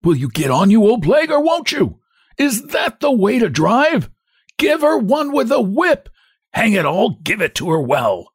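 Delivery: very high, strained impatient scolding; bully the driver in a cracked, high voice; high-pitched outraged urging, easing at the end; indignant, quivering hunchback. A narrator's reading. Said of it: "quivering voice of the hunchback".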